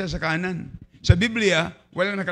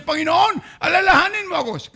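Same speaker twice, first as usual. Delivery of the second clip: much higher, shouted plea to the Lord; pleading cry; 10 semitones higher, 4 dB louder than usual. Acted. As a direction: beg to be remembered. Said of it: "sabi niya".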